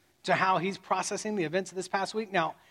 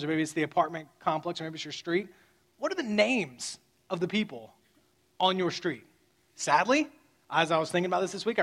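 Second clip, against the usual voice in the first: normal talking voice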